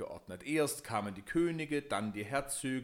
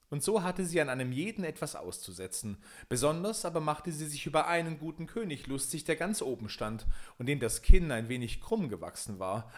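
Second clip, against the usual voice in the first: ordinary delivery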